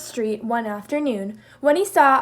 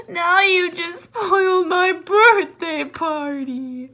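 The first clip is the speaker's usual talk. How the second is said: slow, higher, crying complaint; tearful; 6 semitones higher, 4 dB louder, slower than usual. Acted. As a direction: Moose tearfully blaming Jackal, slow crying. Moose still crying.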